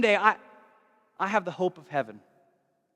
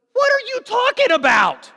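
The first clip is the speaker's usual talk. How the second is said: much higher and louder, disbelieving; incredulous outburst; 8 dB louder than usual. Retold or imitated. Jesus answering the grumbler, loud and incredulous. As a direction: blurt it in total disbelief.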